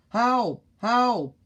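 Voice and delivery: high, loud help calls; calling for help. Acted. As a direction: shout help over the radio. Policeman radios for help.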